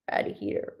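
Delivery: "Ratty heater" breathed in a whisper; "Ratty heater" murmured unvoiced. Shown as a demonstration. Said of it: "I don't even know how to say it".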